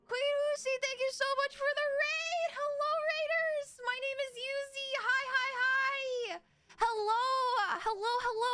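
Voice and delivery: excited greeting. Real, not acted. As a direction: gush hello to the new arrivals. Greeting raiders after surprise raid.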